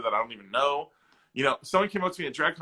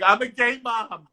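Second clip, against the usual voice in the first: much higher, slow, louder mom proclaiming it; imitating mom; 10 semitones higher, 8 dB louder, slower than usual. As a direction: announce it proudly as the mom. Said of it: "she was like".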